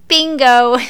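triumphant exclaim. Retold or imitated. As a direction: call it out like you cracked the case. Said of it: "I'm like".

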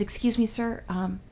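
imitating a hushed, polite passenger; polite soft request. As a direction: softly ask a stranger for quiet. Passenger politely asking for quiet.

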